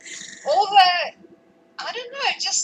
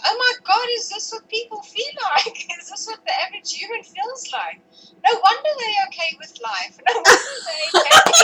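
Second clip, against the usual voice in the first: amazed laughing thought; 7 semitones higher, 4 dB louder than usual. Retold or imitated. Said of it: "And I thought".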